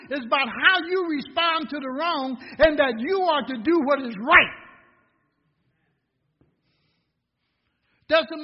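loud, high, ringing sermon voice; full-force preaching. Acted. Pastor exhorting the congregation on handling trouble, preaching loud. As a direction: preach it out to the whole church.

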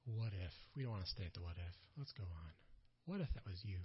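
soft, low reflective questions; preacher musing softly to the church; hushed. Real, not acted. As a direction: draw the listener in quietly.

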